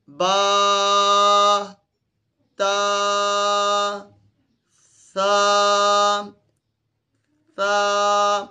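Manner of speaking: "با، تا، سا" each held long on a level, straight vowel; straight, perfect letter sounds. Shown as a demonstration. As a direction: hold "با", "تا" and "سا" straight, no wavering. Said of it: "perfect رکھنا ہے سیدھا".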